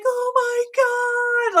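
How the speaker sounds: overjoyed at the lunchbox offer; delighted gasp. Retold or imitated. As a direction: squeal it like the best gift ever. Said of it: "And they'd be like".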